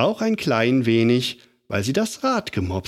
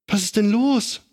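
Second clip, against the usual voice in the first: higher startled aunt calling from the window; a lightly voiced old aunt, alarmed; 10 semitones higher than usual. A narrator's reading. Aunt Berg leaning out the window calls to Lotta. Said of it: "rief sie".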